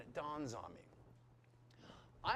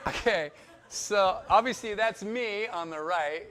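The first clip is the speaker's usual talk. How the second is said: slow, louder words through a real laugh; 3 semitones higher, 11 dB louder than usual. Real, not acted. Laughing while showing photo.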